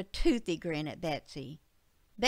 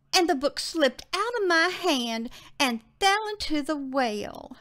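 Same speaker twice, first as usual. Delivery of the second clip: put-on high, louder excited child voice; little girl Betsy; 8 semitones higher, 4 dB louder than usual. Betsy explains the lost book to her dad, high and girlish. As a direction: pitch it up like a girl telling what happened.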